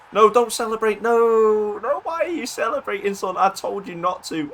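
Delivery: high-pitched, wailing plea; dismayed protest. Real, not acted. Scolds scorer for celebrating.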